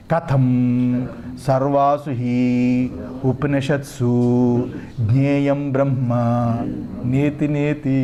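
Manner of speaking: low, slow monotone chant; Sanskrit text recitation. Acted. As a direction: intone each phrase, pausing between them. (సంస్కృత భాష్య పఠనం, నెమ్మదిగా)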